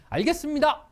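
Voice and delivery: loud, high, eager 알겠습니다; eager yes. Shown as a demonstration. Teacher shows a sincere reply to a boss, eager. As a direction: snap it out keenly. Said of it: "감정이 다르죠".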